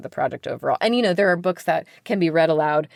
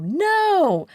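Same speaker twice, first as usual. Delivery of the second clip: put-on sudden high, loud "No!" like a class of kids; kids shouting no; 13 semitones higher, 3 dB louder than usual. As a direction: shout it back like eager children. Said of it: "and all the kids go".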